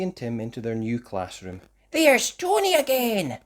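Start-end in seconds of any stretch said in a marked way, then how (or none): 1.9-3.4 put-on much higher, bright child's voice; little girl spotting her friend. A narrator's reading. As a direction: point out a friend eagerly. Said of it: "said Topsy".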